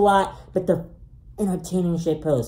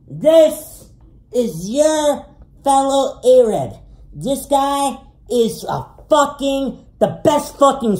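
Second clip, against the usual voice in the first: hyped introduction; 5 semitones higher, 4 dB louder, slower than usual. Acted. Hyped intro of a friend's picture.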